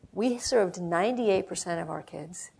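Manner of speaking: ordinary delivery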